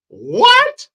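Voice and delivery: very high, loud squawk of disbelief; a stunned high-pitched what; shocked shriek. Real, not acted. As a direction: shriek it in outrage. Shocked reaction to Ramadan news.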